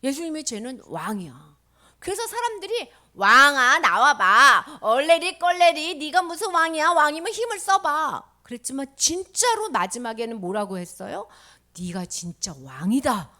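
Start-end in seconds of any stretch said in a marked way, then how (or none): 3.1-8.3 very loud, drawn-out teasing; sing-song jeer at full voice; mocking taunt. Crowd daring the king to show power, loud mockery. 11.8-13.3 slow, lower, weighty statement; solemn declaration. Acted. People finally confessing the true king, slow and solemn.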